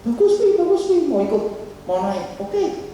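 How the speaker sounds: put-on high, eager little kid begging to ride; excited child voice. Preacher voicing his son begging for the roller coaster, high excited child. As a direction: pitch way up like a kid who wants the roller coaster.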